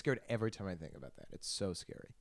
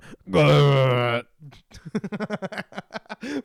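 louder, higher hammed-up sex noise; mock the behemoth's noise through laughter; imitated grunting noise; 6 semitones higher, 15 dB louder than usual. Mocking the behemoth on top of her, loud mock grunting. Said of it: "is on top of her just going".

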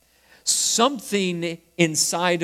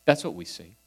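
normal talking voice

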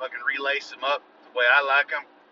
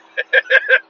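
laughing; 8 dB louder than usual. Real, not acted. Laugh after his boast.

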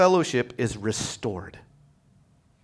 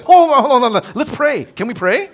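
pleading son; 11 semitones higher, 11 dB louder than usual. Retold or imitated. Son stalling, asking to pray.

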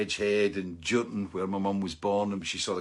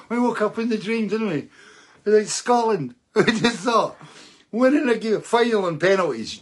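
high and loud, bursting with disbelief; can't believe the win, pitch soaring; thrilled; 11 semitones higher, 8 dB louder than usual. Real, not acted. Fan telling viewers about Scotland's win, giddy and loud.

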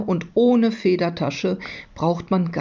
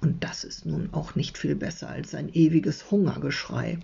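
ordinary delivery, nothing marked

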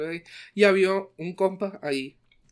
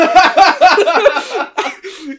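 high loud burst of laughter; 13 semitones higher, 13 dB louder than usual. Real, not acted. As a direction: crack up loudly. Big laugh after quote.

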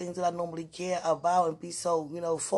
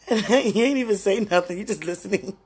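ordinary talk

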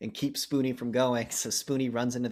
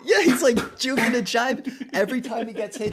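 high, loud excited retelling; 11 semitones higher, 6 dB louder than usual. Real, not acted. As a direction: gush about the fight.

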